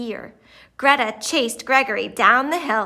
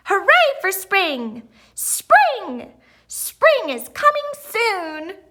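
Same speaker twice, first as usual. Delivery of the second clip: much higher joyful chant; cheering; 8 semitones higher, 3 dB louder, slower than usual. A narrator's reading. Dancing crowd cheers for spring. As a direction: celebrate spring with everyone. Everyone dances and sings.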